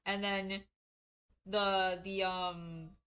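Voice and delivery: ordinary delivery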